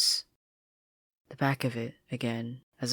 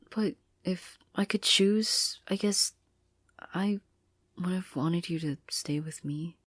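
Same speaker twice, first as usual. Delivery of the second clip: whispered; 6 semitones higher than usual. Acted. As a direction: barely dare to say it. Marcy's painful confession.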